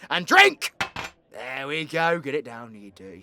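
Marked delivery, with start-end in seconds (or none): 0.1-0.7 loud, high rallying shout; shouted command. Acted. Storyteller orders the listeners to drink, rallying shout. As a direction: punch it out as a toast.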